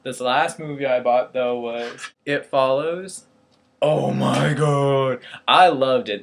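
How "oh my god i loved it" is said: emphatic, thrilled outburst; excited gushing. Real, not acted. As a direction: gush loudly over something you loved. Raving about a favourite movie.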